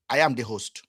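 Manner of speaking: normal talking voice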